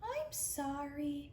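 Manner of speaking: a timid small creature apologising; little voice. Acted. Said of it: "came a little voice".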